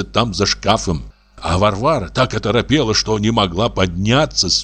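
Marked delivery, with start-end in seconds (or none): none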